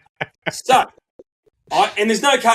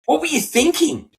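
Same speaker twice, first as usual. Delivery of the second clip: female cop; 12 semitones higher than usual. Retold or imitated. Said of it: "she goes".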